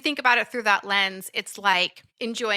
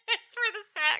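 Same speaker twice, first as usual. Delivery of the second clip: much higher, giggling through it; laughing; 11 semitones higher, 6 dB quieter than usual. Real, not acted. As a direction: laugh the words out. Laughing mock Bella.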